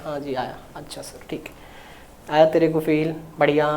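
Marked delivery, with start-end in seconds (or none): 0.0-2.3 softer, lower, shrinking into a timid student's answer; meek student reply. Acted. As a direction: answer like a shy student to the master, almost inaudibly. Teacher mimics meek student answer. (डरे हुए छात्र की तरह धीमा, दबा हुआ जवाब)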